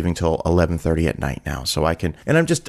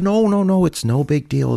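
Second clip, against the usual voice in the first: high-pitched rush to wave off an apology; reassuring protest; 10 semitones higher than usual. Retold or imitated. Server waves off a late guest's apology, insistently reassuring. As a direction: start high on the denials, then ease down.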